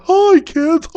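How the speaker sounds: very high squeaky clown voice; clown greeting. Acted. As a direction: chirp it like a kids' party clown.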